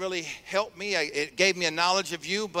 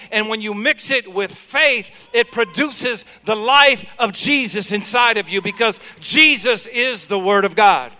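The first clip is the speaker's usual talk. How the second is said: loud, rising sermon delivery; full-force preaching; 4 semitones higher, 7 dB louder than usual. Acted. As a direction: preach it out to the congregation.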